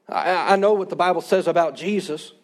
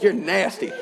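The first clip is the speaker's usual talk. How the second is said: teasing mock disgust; a parent's half-serious put-down; sarcastic scolding. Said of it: "trying to motivate him through sarcasm".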